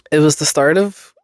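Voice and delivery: ordinary talk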